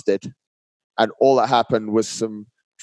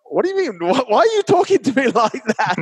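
high-pitched mock-whiny protest with a laugh breaking in; indignant protest; 11 semitones higher than usual. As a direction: protest in a much higher voice, half laughing. Acted protest at partner's blunt reply.

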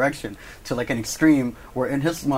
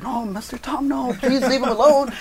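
high, hammed-up principal begging; mock-alarmed appeal, rising in volume; dramatic pleading; 12 semitones higher, 3 dB louder than usual. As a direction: plead to break up a fight. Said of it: "it's, like, very dramatic".

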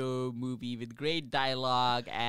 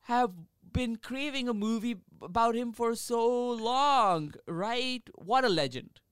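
mock-gushing fan, raised pitch; sarcastic; 7 semitones higher, 6 dB louder than usual. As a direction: sarcastically rave about him. Sarcastic praise of Charles Manson.

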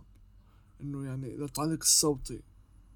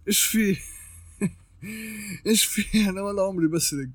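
higher, louder retold outburst of disbelief; startled protest; 4 semitones higher, 5 dB louder than usual. Artist reacting to being accused of stealing a drawing, startled protest. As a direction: act out a baffled denial, breaking into a laugh.

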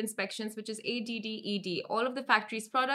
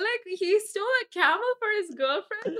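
excited; 11 semitones higher, 6 dB louder than usual. Real, not acted. Gushing over camel theft.

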